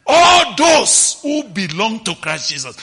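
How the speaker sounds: loud proclaiming cry; preaching shout. Real, not acted. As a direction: ring it out to the congregation. Preaching at full force.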